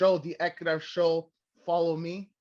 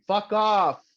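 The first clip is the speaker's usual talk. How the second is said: mock brush-off; 4 semitones higher, 6 dB louder than usual. Mocking her declining followers.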